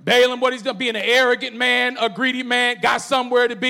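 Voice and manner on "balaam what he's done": usual speaking voice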